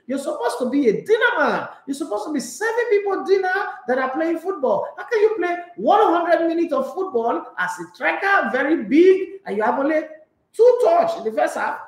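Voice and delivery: high, loud mocking voice, laughing through it; mock Thierry Henry rant. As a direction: ham up Henry scolding Lukaku. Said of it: "he says, he says".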